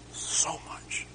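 breathy whispered repeat; hushed, voiceless echo. Real, not acted. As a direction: drop to a bare whisper of regret. Emphatic repeat of regret.

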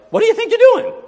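high, loud outraged shout; angry caller's shout; mock yell. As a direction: yell it at the preacher. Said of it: "you're yelling".

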